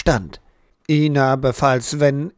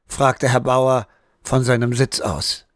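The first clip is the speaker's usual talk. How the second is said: ordinary delivery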